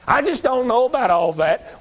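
hammed-up whining skeptic; mocking whine. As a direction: whine it back at the hard-headed. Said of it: "repeated mock of listener".

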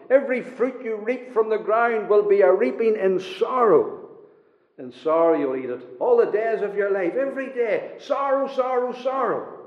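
scripture proclaimed, climbing high in pitch; emphatic preaching. Real, not acted. Preacher recites the curse on Adam to congregation, emphatic preaching. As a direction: hammer out each clause like a preacher.

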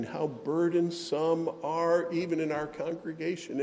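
usual speaking voice

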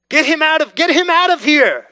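loud, high mock crowd shout. Preacher voicing the crowd rejecting Jesus, loud and high. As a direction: yell it like the angry mob.

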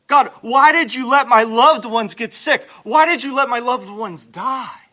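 loud, high, pleading questions to God; a run of loud, raw lament; anguished why-prayers. Acted. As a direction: demand answers from God.

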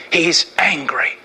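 slow, hushed whisper of warning; urgent whisper. Acted. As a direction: whisper it like a grave secret. Sermon on God's wrath.

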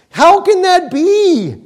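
high, slow incredulous question; doubting listener. Acted. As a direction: ask it like a stunned skeptic. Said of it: "you say".

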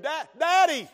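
retold cry for help; imitated child's scream. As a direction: imitate a scared child calling out. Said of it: "he screamed".

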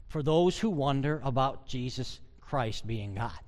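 ordinary delivery

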